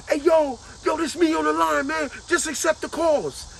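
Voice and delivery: high, loud call from the background; inmate yelling in background. Retold or imitated. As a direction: shout over the operator so they accept. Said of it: "You hear them in the background".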